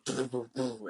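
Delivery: soft puffed "boo" gun noises; imitated gunshots. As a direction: mimic gunfire with your mouth, softly. Imitates gunfire in a film retelling.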